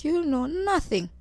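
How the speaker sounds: high, drawn-out knowing boast; smug boast. Retold or imitated. Pupil bragging about how much money she had, smug. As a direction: stretch it out, bragging in secret.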